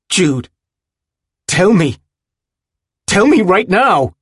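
high, loud, mounting demand; furious shouting. A narrator's reading. Willem demands the truth from his friend, furious shouting. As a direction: shout the order, building in force. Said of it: "he shouts, astonished by his own fury".

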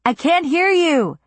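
slow call over the music. A narrator's reading.

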